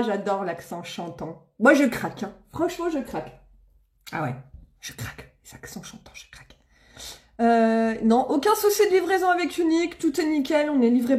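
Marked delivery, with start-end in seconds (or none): 4.1-6.6 barely audible, under the breath; whispered swoon. Real, not acted. Streamer confessing a weakness for accents, whispered. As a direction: whisper it to yourself, smitten.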